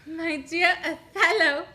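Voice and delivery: slow, loud, drawn-out stage declamation; theatrical Desdemona reply. Acted. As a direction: declaim it to the back row. Actress rehearsing Desdemona's reply.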